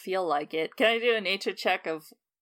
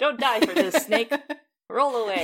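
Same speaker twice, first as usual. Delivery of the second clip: loud, higher-pitched shooing call; 5 semitones higher, 7 dB louder than usual. Acted. Player's character urges the monster to leave, shooing loudly. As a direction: drive the creature away with your voice. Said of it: "a kind of shooing motion".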